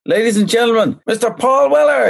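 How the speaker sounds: big, high compere announcement; announcer voice. Retold or imitated. Singer tricking the crowd at the Hundred Club, showman announcer call. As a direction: boom it out like a stage MC introducing the star.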